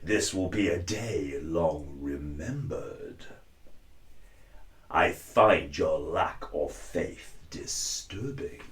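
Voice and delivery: low, soft, slow menacing Vader; slow and in control, a calm threat. Shown as a demonstration. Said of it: "listening to Mustafa in The Lion King".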